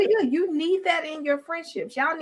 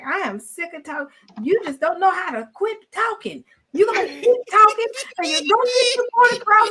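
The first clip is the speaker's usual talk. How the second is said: mock angry; 5 semitones higher, 5 dB louder than usual. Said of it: "He'll get mad and be like".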